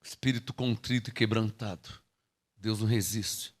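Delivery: quiet sermon aside; hushed scripture. Acted. Soft scripture line.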